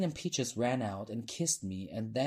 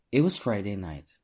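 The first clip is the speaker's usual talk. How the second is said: plain reading voice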